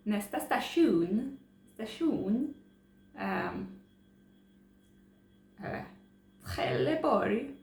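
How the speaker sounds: imitating a South Swedish train announcement, slow and drawn out; South Swedish train announcer. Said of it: "the voice in the trains have the accent".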